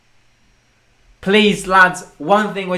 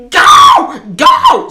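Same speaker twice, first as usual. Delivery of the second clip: imitated mom's yell; 7 dB louder than usual. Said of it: "I heard my mom going".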